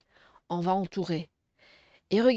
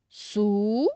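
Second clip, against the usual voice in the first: "Sou-ri" with a long held "Sou", then a gap; syllable by syllable; 3 semitones lower than usual. Shown as a demonstration. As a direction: stretch and separate both halves of "Sou-ri". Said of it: "on va séparer les syllabes".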